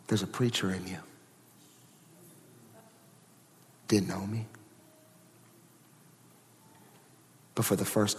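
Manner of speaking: very low, soft, solemn delivery; hushed testimony. Acted. Telling the church his calling was revealed, hushed and solemn. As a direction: let each phrase land quietly between long pauses.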